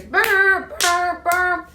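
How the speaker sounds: high-pitched honking noise; imitated car horn. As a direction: mimic an insistent car horn. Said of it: "All we hear is".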